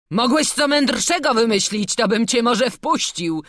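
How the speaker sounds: high, loud scoffing guard; loud derision. A narrator's reading. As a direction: jeer at them from the gate. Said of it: "Ceklarz rozśmiał się głośno". (głośna drwina)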